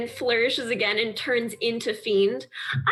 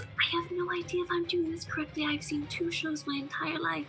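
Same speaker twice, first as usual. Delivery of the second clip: higher, much softer, fast unsure aside; nervous assistant; 6 semitones higher, 8 dB quieter than usual. Acted. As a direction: mutter your doubts to the boss. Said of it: "she looks over to Fiend and goes".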